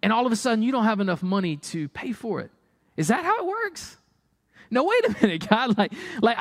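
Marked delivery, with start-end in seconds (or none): 3.0-6.4 high, exasperated plea to God; mock complaint to God, a little laugh in it; frustrated believer to God. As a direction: lightly play a believer fed up with God.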